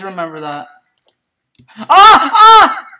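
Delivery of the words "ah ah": high loud yelps; two short loud shrieks; startled yells. Real, not acted. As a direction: cry out in surprise. Destroys track, startled.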